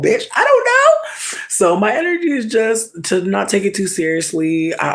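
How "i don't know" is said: a silly falsetto shrug, stretched slow; squeaky high aside. Real, not acted. Squealed aside after rant.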